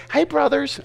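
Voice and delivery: high, light prissy greeting; mock pretty boy greeting. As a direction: lilt it like a pampered little brother. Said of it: "that's how he talked".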